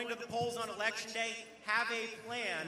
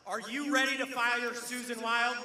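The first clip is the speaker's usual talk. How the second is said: rising, louder crowd call; rally call and response; 4 semitones higher, 6 dB louder than usual. Real, not acted. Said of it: "a little call and response".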